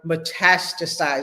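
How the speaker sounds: metastasize drawn out slowly, each syllable clear; slow term. Shown as a demonstration. Teacher repeating the new word metastasize, said slow and clear. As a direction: spell the sound of metastasize out for the class, slowly. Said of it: "Get down that word".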